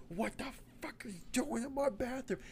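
high, panicked whispered hiss; a scared whisper at an intruder in the bathroom; frantic whisper. Acted. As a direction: whisper in terror, don't let it hear you. Finds alien in her bathroom.

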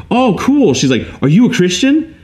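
imitating her bright, eager high voice; high, excited quote of an eager churchgoer. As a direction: coworker asking him, excitedly pitched high. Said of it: "she's super excited".

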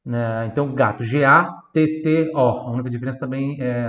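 ordinary delivery

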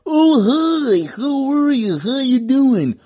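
high, put-on cartoon greeting. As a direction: chirp a friendly high cartoon hello. Another cartoon voice demo.

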